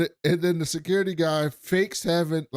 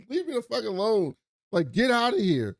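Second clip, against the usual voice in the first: higher, irritated brush-off; mock annoyed waitress; 8 semitones higher than usual. Waitress telling Rob to leave her alone, mock irritated. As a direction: shoo him off, a bit higher. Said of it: "She's like".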